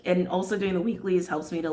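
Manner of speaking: ordinary talk, nothing marked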